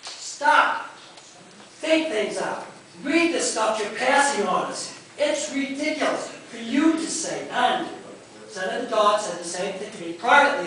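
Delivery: loud, upset scolding; worked up, voice lifting in real exasperation; emotional outburst. Real, not acted. Constituent scolding a congressman over debt, emotional outburst. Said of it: "I got emotional".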